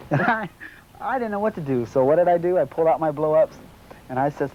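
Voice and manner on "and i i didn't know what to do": laughing in disbelief, higher. Real, not acted. Recalling the captain's jet story, laughing in disbelief. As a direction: laugh through it, baffled.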